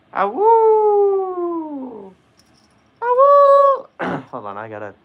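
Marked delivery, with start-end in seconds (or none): 0.1-2.1 very high, loud wolf howl. Pretend. Howling like a wolf. 3.0-3.9 very high, loud strained howl; a first, unprepared howl; howl before choir voice. Pretend. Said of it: "Gotta get my choir voice".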